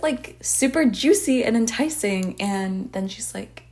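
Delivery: usual speaking voice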